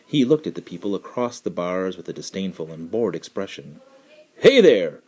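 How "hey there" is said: loud, cheerful hail; jovial call. A narrator's reading. Man hails the caged tiger, loud and jovial. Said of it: "called jovially".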